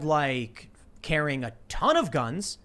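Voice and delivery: ordinary delivery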